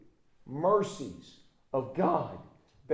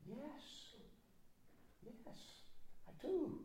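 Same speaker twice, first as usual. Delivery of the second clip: Peter's weary yes, much softer and higher; hushed, hurt yes; 13 semitones higher, 17 dB quieter, much slower than usual. Acted. Peter to Jesus, tired of the question, hushed and higher. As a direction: barely breathe the answer.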